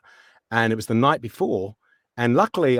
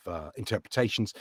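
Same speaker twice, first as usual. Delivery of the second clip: normal talking voice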